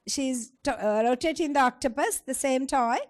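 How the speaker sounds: ordinary talk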